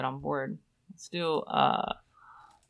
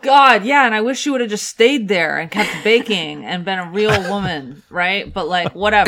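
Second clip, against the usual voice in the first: loud mock outrage; 4 semitones higher, 11 dB louder than usual. Mock disdain for the baker.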